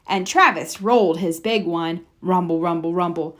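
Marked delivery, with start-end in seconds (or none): none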